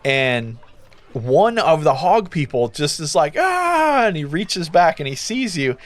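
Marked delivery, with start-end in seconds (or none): 3.4-4.1 high drawn-out "ah"; a hog man's high cry of greeting. Acted. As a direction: let out a long, high "ah". Hog man's exclamation on seeing you.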